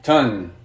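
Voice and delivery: "Tun" low, ending clearly on the N; pronunciation model. Shown as a demonstration. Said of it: "we're gonna put an N at the end".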